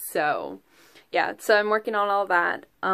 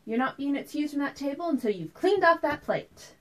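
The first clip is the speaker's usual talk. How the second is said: trumpeting mother; 7 semitones higher than usual. Acted. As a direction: lay down the law as an exasperated mother. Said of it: "she'd trumpet to me".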